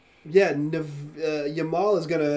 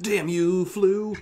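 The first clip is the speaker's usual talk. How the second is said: sputtering foiled villain; 5 semitones higher, 4 dB louder, much slower than usual. Acted. Character curses creature eating arrows.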